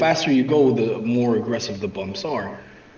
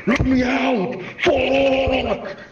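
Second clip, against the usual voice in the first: retold scream; 13 semitones higher, much slower than usual. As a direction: scream to be let out, pitched high. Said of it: "he's just screaming".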